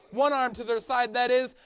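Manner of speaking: ordinary talk, nothing marked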